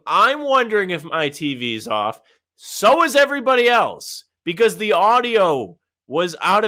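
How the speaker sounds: loud, fired-up rant. Real, not acted. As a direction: vent hard about the broadcast. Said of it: "there's a lot to complain about here".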